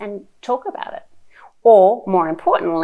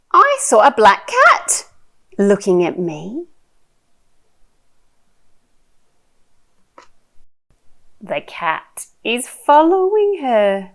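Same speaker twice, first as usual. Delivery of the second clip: animated, slow picture-book reading with big swoops; reading to a toddler; 4 semitones higher, 6 dB louder, much slower than usual. Shown as a demonstration. Mum sharing a picture book with her little one, animated child-directed reading. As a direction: draw out the words, playful and full of wonder.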